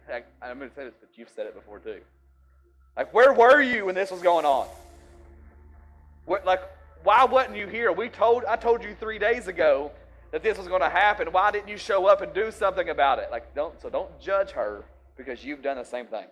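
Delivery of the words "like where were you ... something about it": much higher, much louder accusing shout; furious hammed-up rant at an absent friend; mock angry yell. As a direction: yell the blame at Jesus. Acted angry accusation at Jesus.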